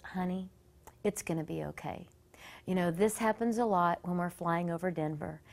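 soft, low soothing grandmother; quiet, kindly reassurance; reassuring grandma. Retold or imitated. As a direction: gently comfort a scared seatmate. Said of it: "this grandma who's to my right".

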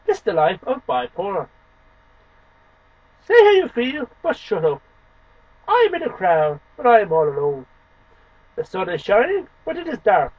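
ordinary delivery

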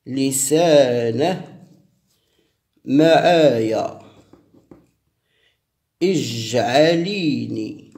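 slow, drawn-out ritual recitation; slow ceremonial chanting of an invocation; incantation. Shown as a demonstration. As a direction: intone each word like a spell. Reads the spell out slowly.

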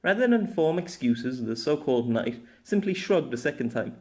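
ordinary delivery, nothing marked

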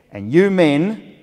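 high, loud and slow address; put-on instructor voice, drawn out and emphatic; imitated lactation consultant. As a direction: boom it out like a stern lecturer. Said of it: "I, I won't do a German accent".

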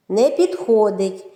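normal talking voice

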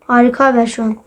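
ordinary talk, nothing marked

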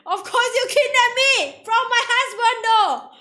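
very loud, high-pitched outburst; excited shouting. Real, not acted.

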